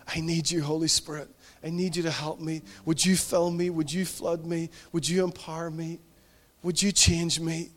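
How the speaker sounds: soft, intimate prayer voice; hushed worship-time prayer; quiet prayer. Acted. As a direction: pray it gently to the Spirit. Leads a yielded prayer.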